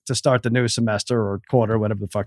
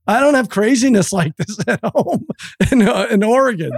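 mock fed-up son; 8 semitones higher than usual. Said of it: "He's like".

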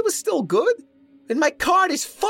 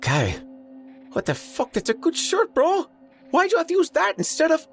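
high-pitched, indignant sputter; whiny, very high outraged complaint; sputtering protest; 3 dB louder than usual. A narrator's reading. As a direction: squeal your objection in disbelief. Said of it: "Mo sputtered".

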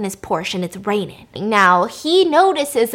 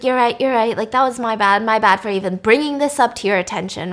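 normal talking voice

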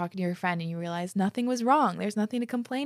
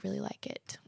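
whisper; 9 dB quieter than usual. Real, not acted. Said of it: "I need to drink some water".

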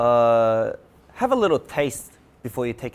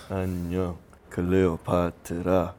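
deep and very slow; low slow voice; 6 semitones lower, 3 dB quieter than usual. Acted. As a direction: drop the voice low and stretch it. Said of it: "we're gonna go as low as we can".

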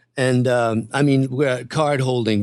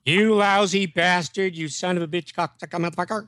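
filthy cursing; 4 semitones higher than usual. Shown as a demonstration. Saying it again for an eager listener, mock cursing. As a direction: roll out the filth on request. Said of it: "Say it again with all the filth".

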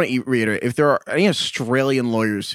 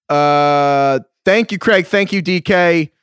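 loud, higher, drawn-out thanks; sign-off call-out; 5 semitones higher, 5 dB louder, much slower than usual. Real, not acted. Host thanking the co-hosts to close the show, loud sign-off.

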